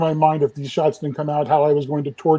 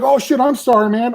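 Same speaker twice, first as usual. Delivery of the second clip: higher-pitched mock apology; retold panicky apology; 8 semitones higher than usual. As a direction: imitate a flustered assistant apologising. Camera assistant apologising in retold story.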